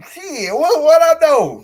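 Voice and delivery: loud, high posing bravado; mock proud poser; hammed-up shrug. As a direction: strike a pose and own it. Posing for the camera.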